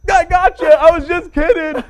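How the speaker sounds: loud high fake breezy cover, as if joking all along; covering the blunder with a big bright high laugh-it-off; mock cover-up. To [name] after his mistake, loud high fake laugh-it-off voice.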